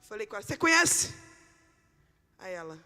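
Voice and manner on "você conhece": bold challenge. Retold or imitated. Repeats the challenge to the woman.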